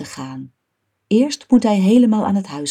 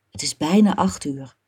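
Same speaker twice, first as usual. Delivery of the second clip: usual speaking voice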